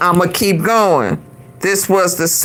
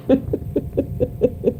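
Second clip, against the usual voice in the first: breathy, quiet wheezing laugh; helpless soft wheeze of laughter; 3 semitones higher, 9 dB quieter than usual. Real, not acted. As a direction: crack up almost without voice. Cracking up at own joke.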